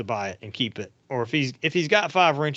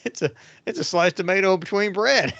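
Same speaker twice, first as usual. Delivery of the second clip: higher, matter-of-fact uncle voice; folksy uncle explaining; 7 semitones higher, 4 dB louder than usual. Retold or imitated. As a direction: explain it like it's obvious. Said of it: "He goes".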